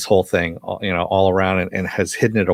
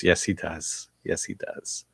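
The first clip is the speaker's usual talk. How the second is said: knowing hush; 4 semitones lower, 9 dB quieter than usual. Real, not acted. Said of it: "knowing repeated whisper".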